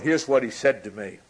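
ordinary talk, nothing marked

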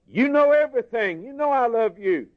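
a hurt disciple insisting he loves him; pleading Peter. Retold or imitated. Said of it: "said to Peter".

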